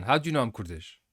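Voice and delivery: ordinary talk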